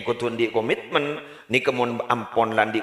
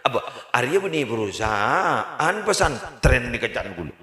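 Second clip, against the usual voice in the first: normal talking voice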